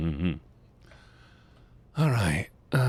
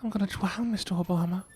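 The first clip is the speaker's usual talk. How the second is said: mock distress; 11 semitones higher than usual. Mock drowning plea.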